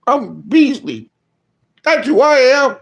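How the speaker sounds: lightly voiced bratty child; defiant kid. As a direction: answer back like a cocky kid. Kid's defiant retort.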